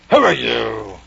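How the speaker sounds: loud, imperious caterpillar. Acted. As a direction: boom it out as a haughty caterpillar. Caterpillar voiced in story reading.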